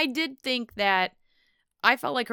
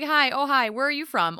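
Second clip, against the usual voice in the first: mock polite; 6 semitones higher, 4 dB louder than usual.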